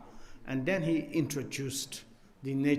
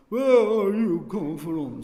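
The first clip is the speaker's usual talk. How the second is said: higher, louder teasing voice at the door; mock gruff question; 5 semitones higher, 9 dB louder than usual. Old host teasing the wandering monk, mock stern. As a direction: play the host jokingly grilling a beggar. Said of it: "I make joke why I'm laughing".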